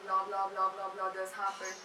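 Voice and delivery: soft, droning lecturer; dull, monotonous lecture voice; low monotone. Shown as a demonstration. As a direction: drone on without any lift. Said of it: "in a very low and monotonous tone".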